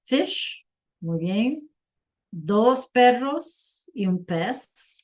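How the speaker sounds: usual speaking voice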